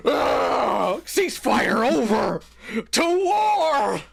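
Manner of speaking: gasp, then mock war cry; hammed-up call to war; mock battle cry. Mock outrage, mocking the guy.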